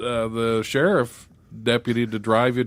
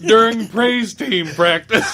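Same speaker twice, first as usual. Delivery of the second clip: laughing; 11 semitones higher, 6 dB louder, slower than usual. Real, not acted. Breaking up over church crash story.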